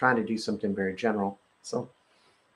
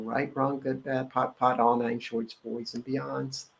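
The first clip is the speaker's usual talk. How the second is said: rote clearing formula; 5 dB quieter than usual. Acted. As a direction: rattle it off like a memorized incantation, trailing quieter at the end. Recites the clearing statement.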